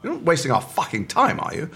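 lightly voiced imposing teacher scolding; a hint of a stern posh coach; stentorian voice coach. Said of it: "very stentorian".

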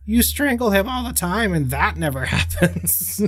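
mock Marge scolding lightly; Marge impression. Imitating Marge telling Homer in a high voice. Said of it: "Marge says to Homer".

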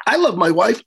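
ordinary talk, nothing marked

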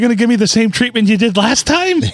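high-pitched mock-eager customer; excited fish voice. Host voices the caught fish asking for seconds, high and eager. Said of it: "he's like".

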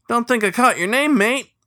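high, loud cheery greeting; cheerful beaver. Acted. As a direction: greet him brightly and friendly. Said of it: "the beaver said cheerfully".

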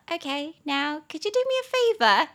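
sweetly high request snapping into a loud "shut up"; joking telling-off. Acted. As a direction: playfully shut a teasing friend up. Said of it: "我就会笑笑的，然后把嘴巴凑到他耳边". (笑着开玩笑叫朋友闭嘴)

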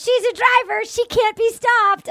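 high, bright mock Gabby voice; imitating Gabby. Host imitates Gabby bragging about herself, high and playful. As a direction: pitch up and play her cheerful boast. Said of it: "She goes".

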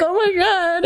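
high mock gasp of amazement. Hearing the pregnancy news, a high stunned gasp. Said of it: "I was just like".

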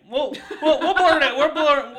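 squeaky laughing blurt; laughing high outburst. Real, not acted. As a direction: voice jumps up laughing.

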